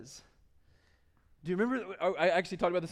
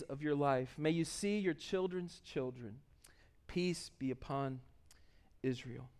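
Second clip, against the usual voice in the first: scripture blessing; 3 semitones lower than usual. Acted. Reads the psalm's closing prayer.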